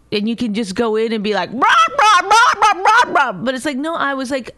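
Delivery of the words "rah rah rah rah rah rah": high, loud mock rant of rah rah rah; loud imitation of a grumpy husband yelling; mock angry ranting. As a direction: ham up a husband barking nonsense at you. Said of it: "And then you got really mad".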